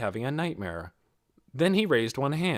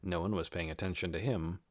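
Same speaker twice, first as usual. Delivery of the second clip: ordinary delivery, nothing marked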